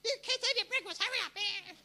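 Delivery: very high, loud nagging mum; hammed-up high-pitched mother yelling orders; mother's authoritative voice. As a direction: screech it like a mum hurrying the kids. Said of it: "She puts on her authoritative voice".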